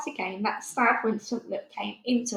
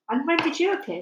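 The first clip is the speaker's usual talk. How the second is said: put-on much higher, a small girl correcting gently; little girl Sophie; 8 semitones higher, 4 dB louder, faster than usual. A narrator's reading. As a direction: lift the voice high like a child. Sophie asking.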